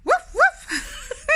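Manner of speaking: very high, loud barking with a laugh; laughing woof. Pretend. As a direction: bark like the dog, cracking up. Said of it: "say the way you say it".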